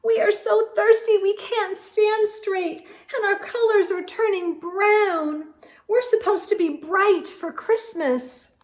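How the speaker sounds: high, loud squeaky flower voices; squeaky little flower voice; squeaking flowers. A narrator's reading. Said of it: "they squeaked some more".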